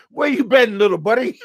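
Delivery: high, giggling hello to a long-lost pal; laughing greeting. Retold or imitated. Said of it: "I go".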